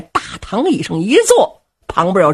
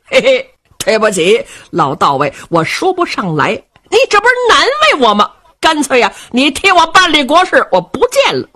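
brash jovial rogue; 5 semitones higher than usual. Acted. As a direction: boom it out like a merry rascal king who doesn't care. Cheng Yaojin voiced in story.

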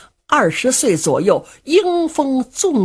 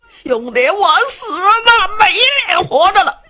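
mock despair; 10 semitones higher than usual. Du Cha threatens suicide before Luo Cheng, mock despairing. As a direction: drag out a hammed-up farewell. Sword at neck, threatening death.

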